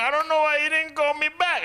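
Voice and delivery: very high put-on girl voice; mock girl voice. Comic voicing the African girl on her phone, high put-on voice.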